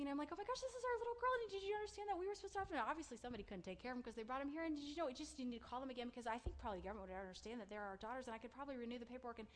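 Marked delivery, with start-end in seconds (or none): 0.3-2.8 high, loud, rushed outburst; acted-out hysterical yelling, very fast; retold frantic screaming. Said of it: "I just started crying and screaming". 2.8-9.4 loud, rushed rambling; acted-out panic, easing from a high yell; retold frantic pleading. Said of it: "making a scene and screaming and crying".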